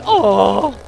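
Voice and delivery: replaying the jolt of bad news; shocked oh. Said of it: "reading that and going".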